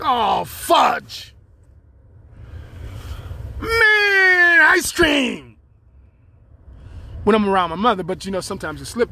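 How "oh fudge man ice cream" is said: clean swear words. Retold or imitated. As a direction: yell the fake cusses like a real curse. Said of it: "so I had to find stuff like".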